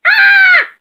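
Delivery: loud, very high scream; sudden rooster shriek while falling; high-pitched cry of alarm. A narrator's reading. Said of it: "he cried and disappeared".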